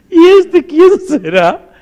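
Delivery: who on earth is this guy, drawn out; suspicious surprise. Retold or imitated. Executive sizing up the stranger.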